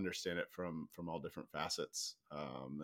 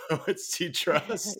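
laughing; 11 semitones higher, 9 dB louder, slower than usual. Real, not acted. Joking about guest's title.

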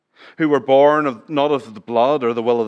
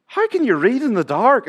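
wife's voice; 11 semitones higher, 4 dB louder than usual. Retold or imitated. Wife's question retold in sermon.

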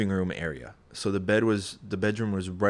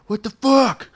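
a startled friend rising into panic; friend freaking out; 8 semitones higher, 4 dB louder than usual. Retold or imitated. Friend waking in the night, building from mutter to high panicked yell. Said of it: "all of a sudden I hear".

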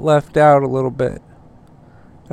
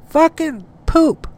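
squeaky voice; 12 semitones higher than usual. Acted. Squeaky outburst.

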